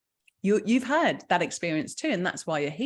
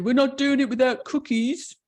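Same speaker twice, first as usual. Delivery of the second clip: imitating husband's stubborn line, louder and higher; Mike's way of talking; 6 semitones higher, 5 dB louder than usual. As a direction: play the husband who won't give up cookies. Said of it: "'cause that's how he talks".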